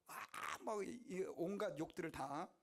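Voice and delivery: ordinary talk, nothing marked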